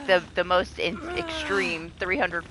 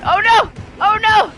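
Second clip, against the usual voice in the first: high, loud repeated yelps; alarmed cry; 9 dB louder than usual. Real, not acted. Streamer reacting to a sudden mishap, loud alarm. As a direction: cry out in sudden dismay, much higher.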